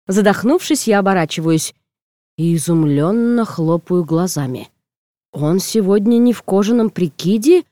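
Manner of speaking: usual speaking voice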